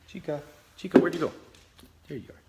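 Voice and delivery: high, loud call to the dog. Real, not acted. Vlogger calling her dog over for viewers, high pet voice. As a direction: call the puppy back.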